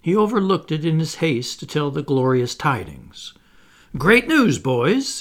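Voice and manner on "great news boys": high, loud announcement; ringing, jubilant call; excited cry. A narrator's reading. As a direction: burst out with the good news. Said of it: "he cried".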